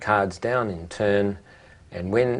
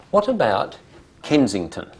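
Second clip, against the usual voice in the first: normal talking voice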